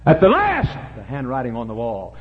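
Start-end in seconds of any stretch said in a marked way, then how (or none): 0.1-1.0 high, loud preacher's cry; shouted refrain. Real, not acted. Preacher warning sinners of the end, sudden shout.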